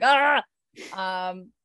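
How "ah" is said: loud, high "Ah!" of panic; mock panicked yell. Podcaster imitating a panicking man, mock panicked. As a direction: imitate a man losing his head. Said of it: "he panicked. He pan-, he was like".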